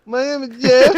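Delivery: Jeff meme. Retold or imitated. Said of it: "He's like".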